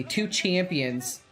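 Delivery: ordinary delivery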